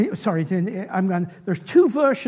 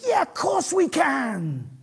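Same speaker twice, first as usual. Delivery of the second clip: higher, drawn-out gung-ho cry; eager enthusiasm; 4 semitones higher, slower than usual. Retold or imitated. Preacher imitating the disciples' reply, enthusiastic. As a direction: call it out slowly with eager confidence. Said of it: "their enthusiasm was such".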